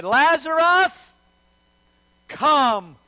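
drawn-out call to the dead; commanding call. Acted. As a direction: summon him by name, slowly. Voices Jesus calling the dead.

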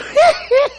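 high, squeaky mock giggle; laughing to herself. Sarah overhearing the men from the tent, tittering to herself. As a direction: imitate a private, very high-pitched titter. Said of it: "She's just laughing in herself".

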